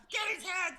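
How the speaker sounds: high, loud laughing yell; laughing shout. Acted. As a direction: shout the order gleefully. Joining the battle cry.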